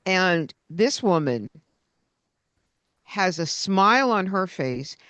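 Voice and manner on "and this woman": usual speaking voice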